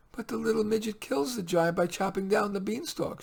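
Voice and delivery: lightly voiced little girl. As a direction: ask like a small anxious child. Child Leah voiced.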